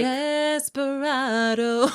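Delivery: slow, high sung-out Desperado; drawn-out echo. Pretend. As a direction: stretch Desperado out slowly. S1 echoes the word, drawn out.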